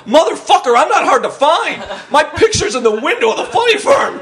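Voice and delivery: comic spotting the suspect who saw him; alarmed outburst. Retold or imitated. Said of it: "And I'm like".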